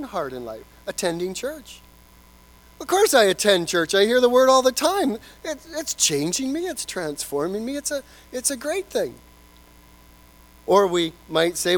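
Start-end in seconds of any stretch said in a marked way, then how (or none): 2.8-9.1 high, confident boast of a self-deceived churchgoer; mock-confident churchgoer; smug self-assurance. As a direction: sound sure of yourself, pitch up high. Voicing self-deception.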